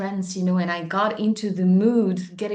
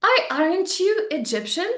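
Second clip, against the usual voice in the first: shocked question; 8 semitones higher than usual. Retold or imitated. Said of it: "but shocked".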